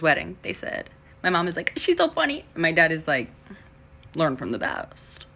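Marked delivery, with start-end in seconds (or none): 1.8-2.4 high-pitched doting mom voice; mock maternal gushing, pitched way up; imitating mom. Voices mom watching the speech. 4.1-5.3 low, slow dad voice; softer, deep, unhurried father impression; imitating dad. Voices dad's reply to mom.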